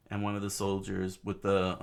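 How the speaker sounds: plain reading voice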